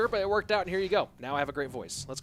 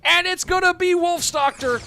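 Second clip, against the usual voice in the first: excited shout; 5 semitones higher, 6 dB louder than usual. Real, not acted. Climax of the leak battle.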